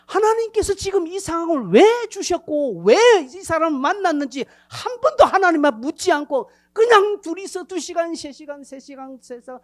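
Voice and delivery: high, loud impassioned rebuke; fiery preaching. Acted. Preacher rebuking the congregation's idle chatter, loud and high. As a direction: thunder it at the congregation.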